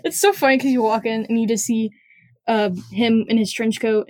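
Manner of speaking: usual speaking voice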